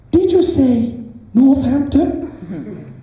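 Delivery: high, slow, mock-innocent device voice; sat-nav voice. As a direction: play dumb as a sat-nav. Sat-nav mishears on purpose.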